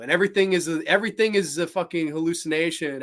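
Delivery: usual speaking voice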